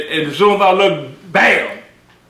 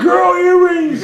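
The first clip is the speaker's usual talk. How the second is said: high, slow, laughing disbelief; mock disbelief; 10 semitones higher, slower than usual. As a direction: stretch it out in amused shock. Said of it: "I said".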